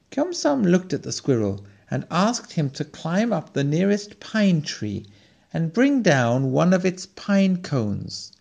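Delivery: ordinary delivery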